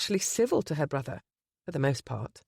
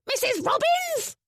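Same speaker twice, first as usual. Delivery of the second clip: much higher, shrill bird squawk; squawking parrot. Acted. Parrot Delilah calling to Penny, high squawk. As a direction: screech it like a parrot.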